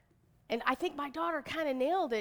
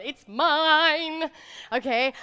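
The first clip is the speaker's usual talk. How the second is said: excited proud; 5 semitones higher, 9 dB louder, much slower than usual. Real, not acted.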